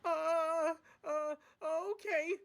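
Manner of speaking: high, hesitant frightened voice; shaky and scared. Acted. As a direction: stammer it out, scared. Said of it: "said the freight cars shakingly".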